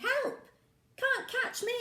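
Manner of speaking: high playful taunting; high, gleeful taunt; teasing child voice. Acted. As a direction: call out like a cheeky kid. Recap of teasing.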